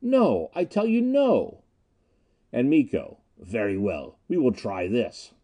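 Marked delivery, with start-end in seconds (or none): 0.0-1.6 high, drawn-out cry of defiance; defiant refusal. A narrator's reading. Snap refuses to give in to Miko, defiant. Captive defying torturer. 3.4-5.3 menacing. A narrator's reading. Torturer answers captive's refusal.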